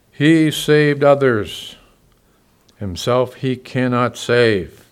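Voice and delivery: slow, loud sneering jeer; mocking taunt. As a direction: pastor voicing the chief priests mocking Jesus, slow and loud.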